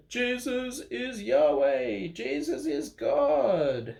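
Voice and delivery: high, slow, triumphant cry; joyful proclamation. Acted. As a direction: call it out like a cheering crowd. People proclaim after the resurrection.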